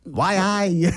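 Geordie. Pretend. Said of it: "Geordie Shore".

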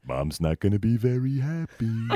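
sitcom-actor impression; Brad Garrett. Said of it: "Brad Garrett from".

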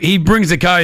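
ordinary delivery

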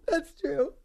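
laughing while talking. Real, not acted. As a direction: get the words out through laughter. Laughing at co-host's joke.

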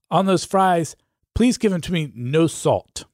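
ordinary delivery

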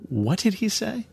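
high-pitched, incredulous question; baffled disbelief. Acted. Friends on the roof to one another, baffled and incredulous. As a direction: act out a stunned double-take.

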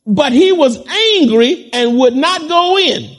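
indignant preacher voicing the sulking elder brother; angry reading. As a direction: read it as if the brother is fuming.

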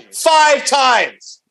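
high, loud disbelief; exasperated. Real, not acted. Exasperated complaint about repeats.

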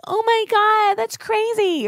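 high, gushing mock-sympathetic mom. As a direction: squeal it like an overly sweet mom. Imagined caring mom reaction.

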